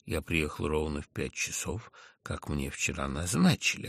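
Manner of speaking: plain reading voice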